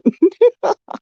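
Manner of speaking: high, helpless laugh; high burst of laughter. Real, not acted. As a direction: crack up at the rant. Listener cracking up at rant.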